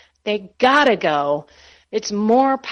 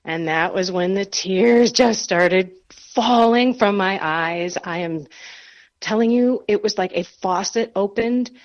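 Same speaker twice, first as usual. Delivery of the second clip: ordinary delivery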